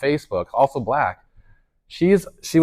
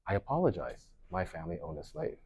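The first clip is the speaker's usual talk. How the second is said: soft, low retold apology; hushed confession; 5 semitones lower, 15 dB quieter than usual. Pastor apologizing at the rally for his family, hushed confession. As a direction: confess it quietly, heavy-hearted.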